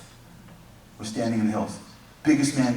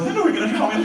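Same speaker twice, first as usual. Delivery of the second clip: high mock protest; 12 semitones higher, 4 dB louder, faster than usual. Followers protest, voiced comically.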